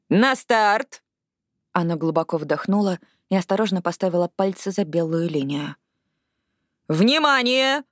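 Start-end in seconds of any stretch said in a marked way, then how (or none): 0.1-1.0 starter's call. Acted. Starter calls runners to blocks. 6.9-7.8 higher, held-out starting command; starter's call. Acted. Starter calls runners to get set.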